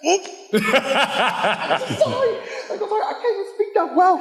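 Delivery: giggling through an apology; embarrassed laughing. Real, not acted. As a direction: laugh nervously while apologizing. Nervous fan laughs through apology.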